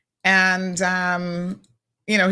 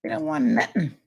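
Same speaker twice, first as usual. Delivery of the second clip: high-pitched mock protest; put-on high objecting voice; mimicking Brandon; 9 semitones higher than usual. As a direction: imitate someone refusing, voice pushed way up high. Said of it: "Brandon's like".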